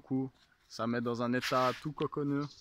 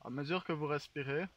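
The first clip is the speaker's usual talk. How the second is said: guided meditation; 5 dB quieter than usual. Acted. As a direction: lull them into calm. Leads a mock guided meditation.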